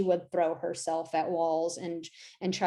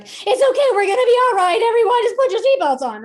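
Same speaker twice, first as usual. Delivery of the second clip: much higher and louder, shrill mock panic; a pilot losing it while saying all is fine; panicking pilot; 14 semitones higher, 10 dB louder, faster than usual. Pilot announcing turbulence to passengers, hammed-up panic. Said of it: "the pilot came on and he was like".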